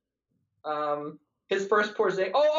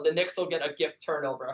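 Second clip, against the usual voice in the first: ordinary talk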